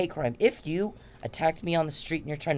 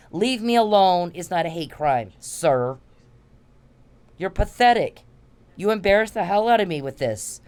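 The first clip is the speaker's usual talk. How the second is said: angry rant; 5 semitones higher, 5 dB louder than usual. Real, not acted. Ranting at a harasser she addresses.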